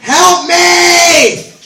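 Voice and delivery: screamed high and very loud; a full-throated comic scream for help; desperate shout. Acted. Comic to the audience, screaming a hammed-up cry for help. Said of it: "a literal cry for help".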